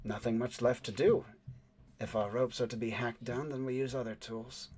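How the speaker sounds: soft, smooth, unhurried lady musing aloud; a poised woman, quietly amused; smooth woman's voice. Acted. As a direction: let it glide, cool and quiet. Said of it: "the smooth voice of the woman".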